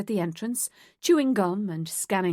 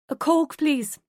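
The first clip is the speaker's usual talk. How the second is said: raised voice over music; 6 semitones higher, 4 dB louder than usual. Acted. Spoken aloud over music.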